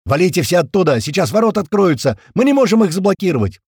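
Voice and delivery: high, loud urgent warning; squealing radio voice. A narrator's reading. Gera warning that the gates will open, squealing. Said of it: "заверещала рация".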